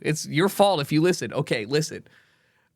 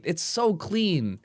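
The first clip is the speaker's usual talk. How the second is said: ordinary talk, nothing marked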